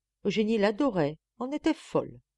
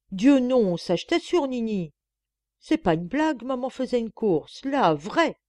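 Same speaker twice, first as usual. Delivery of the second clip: put-on boy protesting; 5 semitones higher, 6 dB louder than usual.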